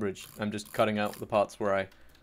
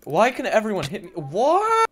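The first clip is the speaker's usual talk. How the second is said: high, loud gamer outrage; exasperated protest; 11 semitones higher, 8 dB louder than usual. Real, not acted. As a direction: build to a shrieked question. Protesting being hit in game.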